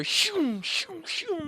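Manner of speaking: high, airy whooshing noises; whoosh sounds. Retold or imitated. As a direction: mimic lights zooming by. Imitating lights whooshing past.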